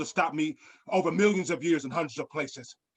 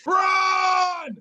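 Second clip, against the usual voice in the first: high, loud yell to run; panicked high shout; frantic shout; 6 dB louder than usual. Acted. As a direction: scream the warning as it charges. Dinosaur charging at them.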